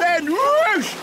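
high, swooping storybook whoosh; excited narration. A narrator's reading. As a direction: send the sound word soaring. Narrating bottle shooting up waterfall.

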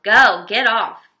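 loud, slow snarl of go, get off. A narrator's reading. Man in a silver necktie snarling at the boys. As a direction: drive them off the car.

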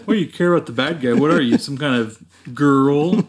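fast, laughing mock tough-guy jab; mock macho cop. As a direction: rattle it off as a sneering cop. Mocking the cop's line.

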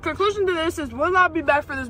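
chewing while talking; muffled eating speech; mouth full of burger. Real, not acted. Seen 4: Bites burger at +0.1; lips closed, chewing motions until ~1.0s.

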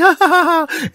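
squeaky gleeful cackle; a sharp high kid laugh; high mocking laugh. Bad kid laughing at the victim, high mocking laugh.

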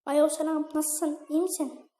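a hint of a girl's voice. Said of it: "Kız sesi yapacağım". (hafif kız sesi)

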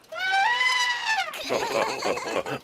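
very high, loud burst of laughter; amused laugh. Acted. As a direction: laugh out at the clever boy. Guide laughs at boast.

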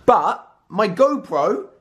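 normal talking voice

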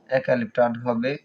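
ordinary talk

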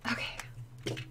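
barely audible whisper to self; whispered okay. Real, not acted. Streamer collecting herself before showing gift, whispering. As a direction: whisper it under the breath. Composing herself after crying.

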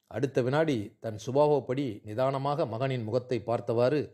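ordinary delivery, nothing marked

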